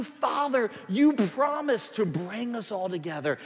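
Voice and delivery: high, loud pleading prayer to God; voicing a fervent prayer. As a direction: cry out to God like Nehemiah. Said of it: "He said".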